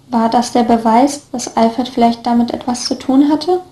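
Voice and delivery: plain reading voice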